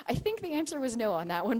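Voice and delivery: ordinary talk, nothing marked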